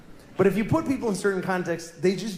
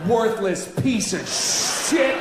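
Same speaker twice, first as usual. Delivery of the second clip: mock shouted insult; 3 semitones higher, much slower than usual. Man insulting a stranger in an elevator, slow mock shout. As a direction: spit it slowly in someone's face. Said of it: "would you ever turn right to their face".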